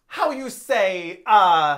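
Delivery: slow, groping fake Italian accent; mock a bad Italian accent hunting for a word; imitating a clumsy Italian accent. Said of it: "he's speaking in his bad accent".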